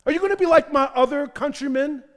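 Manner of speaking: loud preaching challenge; rising plea. Acted. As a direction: press it on Nehemiah. Preacher voicing plea at full force.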